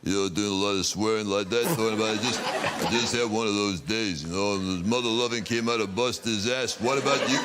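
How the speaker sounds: thick-tongued American tough guy; Sylvester Stallone. Acted. Comic reviving old routine of film star, tough-guy American voice. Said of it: "I used to do Sylvester Stallone".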